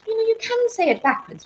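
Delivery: high-pitched film quote; Mary Poppins. Acted. Host repeating the film line for fun, high character voice. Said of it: "I watched Mary Poppins".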